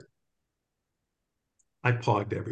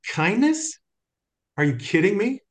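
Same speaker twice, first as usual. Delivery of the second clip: high incredulous rise on kindness; can't believe what you just heard; retold disbelief; 9 semitones higher than usual. Speaker answering Father, mock incredulous and high. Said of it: "I looked at him and I went".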